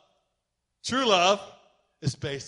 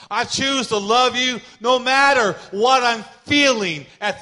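full-force preaching; 6 semitones higher, 9 dB louder than usual. Acted. Pastor to congregation, preaching at full force. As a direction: preach it out to the room.

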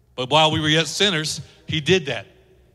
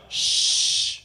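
long hushing "shh"; drawn-out shush; 4 dB quieter than usual. Acted. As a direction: hush someone noisy. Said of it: "and they're like".

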